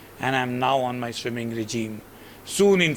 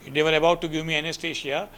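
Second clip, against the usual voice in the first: usual speaking voice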